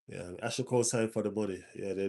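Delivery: usual speaking voice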